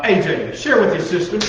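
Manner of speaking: lightly voiced nagging mother; mom voice. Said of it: "I was trying to sound like your mom".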